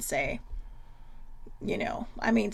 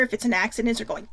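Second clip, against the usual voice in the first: ordinary talk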